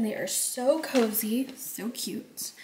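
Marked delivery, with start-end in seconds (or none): 1.6-2.5 soft whispered aside; hushed delighted whisper. Real, not acted. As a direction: breathe it out like a little secret. Cozy slippers, hushed aside.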